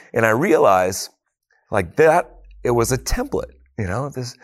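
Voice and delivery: normal talking voice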